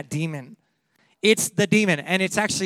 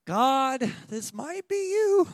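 much higher, softer, slow and wavering; doubtful Peter; 6 semitones higher, 5 dB quieter, much slower than usual. Retold or imitated. Peter stepping onto the water: high, soft, unsure. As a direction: tiptoe through the words in doubt.

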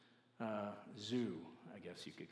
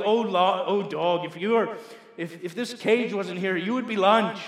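loud, lightly voiced lion threat; menacing lion; 6 semitones higher, 13 dB louder than usual. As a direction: warn the dog off as the big lion, loudly. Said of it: "the lion is looking at this dog like".

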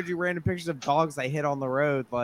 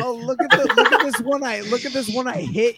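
mock gleeful show-off; 6 semitones higher, 7 dB louder than usual. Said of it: "Be like".

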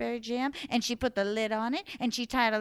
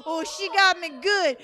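put-on the man greeting the old woman at his door; willy-nilly man; 5 dB louder than usual. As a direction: boom it out like a greedy old man. The man laughing at the trick.

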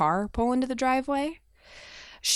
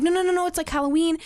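higher, a bit louder hurried mock comforting; mom reassuring; 9 semitones higher, 3 dB louder, faster than usual. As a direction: quickly hush the frightened kid, lightly imitated. Mom calming the scared child.

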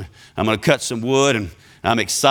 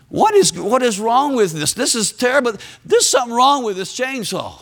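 exasperated, higher-pitched outburst; frustrated; 9 semitones higher than usual. Acted. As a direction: act fed up with a useless tool. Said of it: "Man, I'm getting frustrated".

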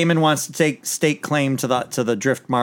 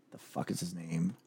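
a low muttered aside to himself; muttered to self; 7 semitones lower, 18 dB quieter than usual. Real, not acted. Host muttering to himself, trying to recall the actor's name. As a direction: drop almost to a hush, searching for a name.

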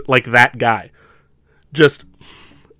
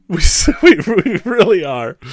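laughing; 6 semitones higher than usual. Real, not acted. Laughing agreement.

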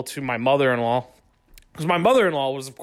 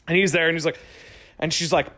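ordinary delivery

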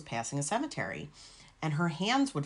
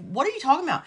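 baffled; 11 semitones higher, 5 dB louder, much faster than usual. Retold or imitated. Confused reaction to sister.

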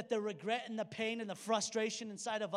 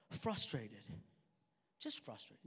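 restrained frustration; 11 semitones lower, 5 dB quieter than usual. Retold or imitated. Retelling his restrained denial of anger.

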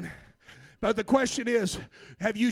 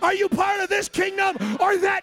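preaching at full force; 7 semitones higher than usual. Acted. Preacher crying out to the church in full force. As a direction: build the call to a shout.

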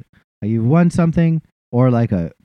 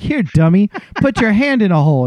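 higher, louder mock order; mock bossy; 8 semitones higher, 3 dB louder than usual. Nurse ordering the husband to his spot, mock bossy. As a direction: boss the clueless guy around. Staff ordering him around.

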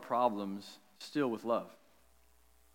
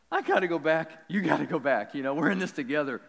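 firm insistence; 4 semitones higher, 5 dB louder than usual. Retold or imitated. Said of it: "And I'm like".

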